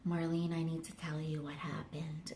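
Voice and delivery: sheepish confession. Retold or imitated. Mom quietly breaks the news of the missing rabbit, hushed. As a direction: confess it softly, a little guilty. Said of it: "she kind of more so feels bad".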